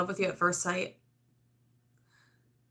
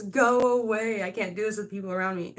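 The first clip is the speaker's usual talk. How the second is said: mock fed-up plea. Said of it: "The High Priestess".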